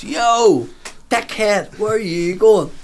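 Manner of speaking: high, loud heckle across the street; mock calling out. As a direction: imitate a drinker shouting after a passer-by. Drinker calls out to passer-by.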